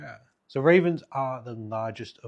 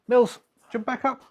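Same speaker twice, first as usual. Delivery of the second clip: high, loud call to the raven; 13 semitones higher, 9 dB louder than usual. Real, not acted. Owner calling his raven back up, high and loud. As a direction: call the bird back up to its perch.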